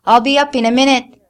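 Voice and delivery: loud call up the stairs. A narrator's reading. Mother calling up to her daughter, loud. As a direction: call out reassurance from below.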